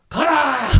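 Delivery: high, drawn-out battle order; commanding shout. Acted. The Lord orders his army to go, commanding shout. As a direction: call out the Lord's command. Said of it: "명령".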